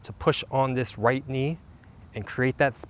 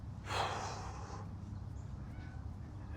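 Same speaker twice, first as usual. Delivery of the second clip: long soft exhale, almost silent; deep release sigh; 15 dB quieter than usual. Acted. Coach settling listeners before eyes close, deep sigh. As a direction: let it all go on a sigh.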